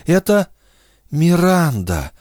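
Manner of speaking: plain reading voice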